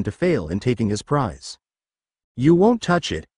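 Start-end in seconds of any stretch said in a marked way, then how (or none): 2.4-3.3 raised, higher defiant shout. A narrator's reading. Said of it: "the Uzumaki shouted".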